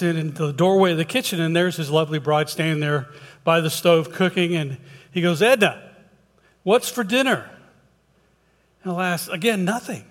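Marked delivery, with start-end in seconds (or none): 5.4-7.5 calling out. Acted. Husband calls to wife from the kitchen doorway, calling out. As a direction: call over to the cook.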